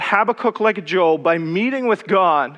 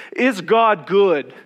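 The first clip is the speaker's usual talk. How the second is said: slow question; 3 dB louder, much slower than usual. Retold or imitated. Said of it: "if you asked them the question".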